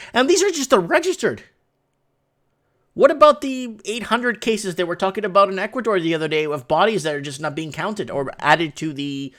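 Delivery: normal talking voice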